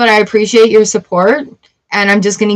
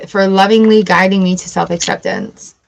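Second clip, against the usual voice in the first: usual speaking voice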